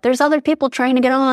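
exasperated mock protest. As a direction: blurt it at the person blocking the metro door. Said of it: "I'm like".

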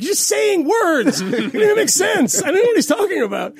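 replying to Gorka, high and exasperated; incredulous retort. Retold or imitated. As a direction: squeal it like the words make no sense. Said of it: "And I'm like".

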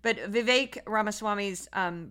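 normal talking voice

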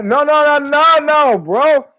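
high, loud laughing no's; excited denial. Real, not acted. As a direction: laugh it off loudly.

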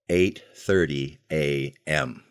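ordinary delivery, nothing marked

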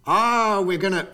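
put-on a loud, high-pitched start of a Russian accent, broken off. Host starts mimicking the Russians, in a loud Russian accent. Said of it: "I'm not gonna do a Russian accent".

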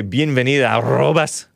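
"bienvenida, arrobas" with a trilled R; demonstrated Spanish pronunciation. Shown as a demonstration. Showing listeners the inclusive greeting, "arrobas" with rolled R. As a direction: roll the R firmly in "arrobas". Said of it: "they say".

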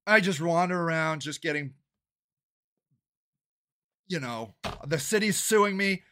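ordinary talk

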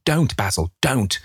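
high, pleading cry without extra volume; desperate cry. A narrator's reading. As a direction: beg him to stop, pitch leaping up. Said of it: "he cried".

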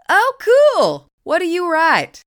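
bright, high burst of eagerness; energetic. Acted. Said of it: "renewed energy in her voice".

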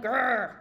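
loud, short playful "grr"; mock hunger growl. Teacher playing ghrelin demanding food, mock growl. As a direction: growl like a demanding empty stomach.

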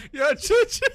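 high, loud plea through laughter; cracking up, beg them to stop; real laughing protest. Real, not acted. Begging co-host to stop teasing about Facebook, helpless laughing protest.

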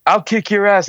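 high-pitched mock threat; drunken threat. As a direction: imitate a belligerent drunk wanting a fight. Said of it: "He's all drunk".

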